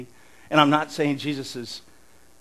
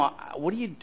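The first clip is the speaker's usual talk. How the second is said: ordinary talk, nothing marked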